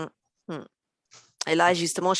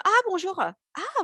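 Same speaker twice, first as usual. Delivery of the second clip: surprised stranger; 11 semitones higher, slower than usual. Retold or imitated. Acting out a surprised stranger's greeting.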